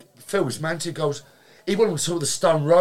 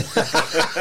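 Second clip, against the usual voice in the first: laugh; 2 semitones higher than usual. Real, not acted. Laughing at his own story.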